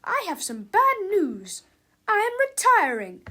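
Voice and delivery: loud, high-pitched teacher voice; shouted announcement. A narrator's reading. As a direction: announce it loudly to the whole class. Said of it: "shouted Miss Ribble".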